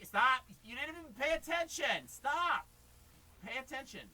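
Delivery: exasperated outburst. Real, not acted. Teacher exasperated that the student isn't paying attention, loud and high. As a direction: snap at him to pay attention.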